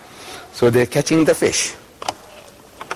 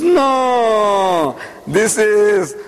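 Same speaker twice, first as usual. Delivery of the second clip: long, sustained higher-pitched "No"; drawn-out reveal, held and sing-song; dawning realization; 5 semitones higher, much slower than usual. Retold or imitated. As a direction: stretch each word out as it dawns. Retelling his moment of discovery.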